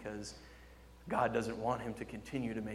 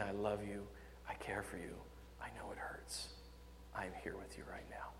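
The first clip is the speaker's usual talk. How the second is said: much softer, fading to a whisper; tender Father; 5 semitones lower, 14 dB quieter than usual. Acted. Preacher voicing God whispering comfort to his child. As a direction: comfort a hurting child gently. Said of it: "the loving arms of our Heavenly Father".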